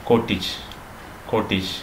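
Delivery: കോട്ടേജ് as "KOT-ij"; short "-ij" ending on കോട്ടേജ്; correct pronunciation. Shown as a demonstration. Teacher modelling for learners, കോട്ടേജ് with "-tij". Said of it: "അല്ല".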